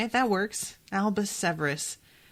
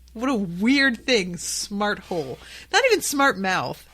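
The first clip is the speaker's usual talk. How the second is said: normal talking voice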